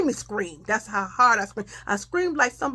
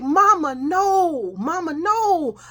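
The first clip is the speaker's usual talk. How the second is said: high, drawn-out wail of objection; imitated pleading refusal; 8 semitones higher, 4 dB louder, much slower than usual. Child begging mama not to send her, wailing refusal. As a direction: plead loudly like a distressed child.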